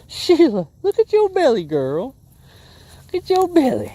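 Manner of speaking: very high, loud cooing to the fish; baby talk to fish. Acted. Angler cooing at his caught bass. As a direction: coo at her like a pet.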